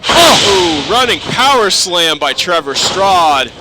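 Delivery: shouted finisher call; wrestling commentator. Acted. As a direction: scream the finishing move. Finishing move and pinfall.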